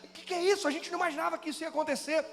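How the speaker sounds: high, hurried cry of disbelief; stunned enemy. Acted. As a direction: act out a foe caught off guard. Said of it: "Eles foram surpreendidos". (inimigo espantado, voz aguda e apressada)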